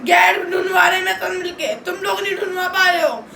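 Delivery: real heated outburst; angry, upset tirade. Real, not acted. Berates family for not helping search.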